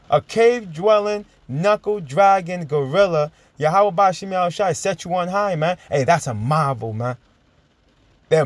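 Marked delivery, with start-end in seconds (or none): 0.1-5.8 mock scripture reading. Preacher mocking his opponent in a verse-like cadence. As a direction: draw out the taunt like a verse.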